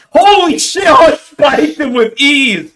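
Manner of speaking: high, loud, slow crowing; hype brag. Retold or imitated. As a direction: show off to get in his head. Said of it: "Be like".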